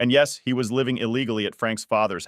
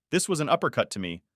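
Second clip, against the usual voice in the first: plain reading voice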